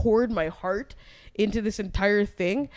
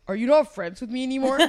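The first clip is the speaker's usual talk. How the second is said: mimicked friend; 5 semitones higher, 4 dB louder than usual. Mimics friend's worried question.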